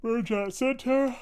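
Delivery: words stretched through a yawn. Real, not acted. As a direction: say it mid-yawn, high and slow. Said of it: "sorry for yawning".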